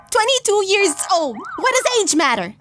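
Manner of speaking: high put-on cartoonish voice; defensive weirdo. Boy Kisser defending his age, high defensive voice. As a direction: answer defensively, pitched high. Chatbot defends himself when questioned.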